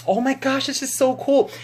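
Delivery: high-pitched imitated gush; star's delighted squeal, retold; Jennifer admiring a tattoo. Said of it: "she was like".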